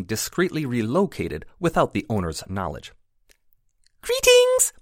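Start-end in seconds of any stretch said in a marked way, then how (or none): 4.0-4.7 very high, airy squeak of a tiny creature; squeaky pixie greeting. A narrator's reading. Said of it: "The speaker's high voice squeaked".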